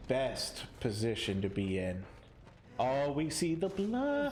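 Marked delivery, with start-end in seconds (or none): 2.8-4.3 voice cracking. Real, not acted. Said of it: "Oh, my voice cracked".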